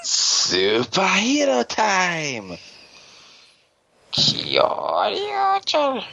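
high, drawn-out show title call; title announcement. Acted. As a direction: stretch the title out, high and slow. Shouting the show's title intro.

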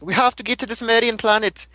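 high-pitched, badly faked accent; horrible Carlos accent. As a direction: attempt an accent and botch it.

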